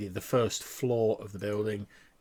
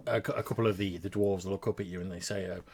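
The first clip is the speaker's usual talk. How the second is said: ordinary talk, nothing marked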